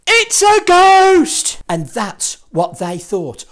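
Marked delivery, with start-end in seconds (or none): 0.1-1.6 mock-terrified shout. A narrator's reading. Frightened disciple guessing again.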